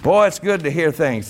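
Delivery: ordinary delivery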